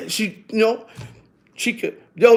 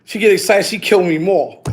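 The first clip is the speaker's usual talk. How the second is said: usual speaking voice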